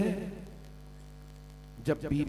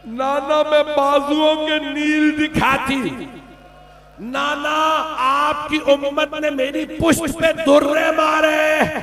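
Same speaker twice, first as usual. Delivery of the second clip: wailing lament; 8 semitones higher, 7 dB louder than usual. Acted. Zainab tells wounds to Prophet.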